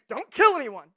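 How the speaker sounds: loud, emphatic order; proclaimed command. Acted. Preacher voicing the Ten Commandments, loud command. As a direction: boom it out as a rule.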